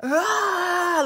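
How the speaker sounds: a loud, high release cry; primal cry. Shown as a demonstration. Teacher showing a release cry into a pillow. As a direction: let it all out into the pillow. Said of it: "So get a good pillow".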